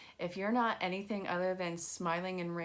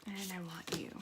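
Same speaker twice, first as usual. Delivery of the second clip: whispering; 6 dB quieter than usual. Real, not acted. Aside while reading cards.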